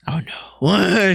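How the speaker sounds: high, drawn-out mock "oh no"; mock dread. Son reacting to mom's news of a court letter, mock dread. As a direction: play up the dismay, pitched well up.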